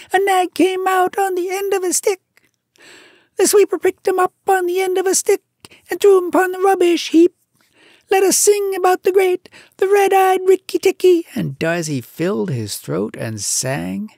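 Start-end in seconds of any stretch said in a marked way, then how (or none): 0.1-11.3 very high, loud chirpy bird voice; a shrill little bird, bursting with triumph; tailorbird. A narrator's reading. Bird tells the mongoose the news, triumphant. Darzee speaking.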